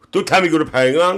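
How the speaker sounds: ordinary delivery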